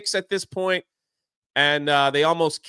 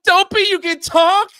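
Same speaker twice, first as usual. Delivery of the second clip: much higher and louder in amazement; shocked squeal; 13 semitones higher, 9 dB louder than usual. Retold or imitated. Dwarves shocked that Dopey can talk, in a loud, high squeal. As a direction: squeal it like you can't believe it.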